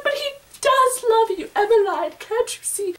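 high pleading voice; mock-dramatic high plea; acted plea. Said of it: "I'm like".

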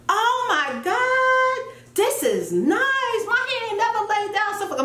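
high, drawn-out, almost sung gush; delighted amazement. Retold or imitated. Woman retelling her delight at her hair in the shower, delighted amazement. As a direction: gush over it, stretching the vowels high.

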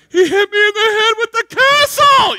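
put-on high, loud whiny child wail; imitating a tearful tattling daughter; freaking-out little girl. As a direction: wail it like an upset little sister. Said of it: "she's freaking out".

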